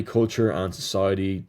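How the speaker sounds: ordinary delivery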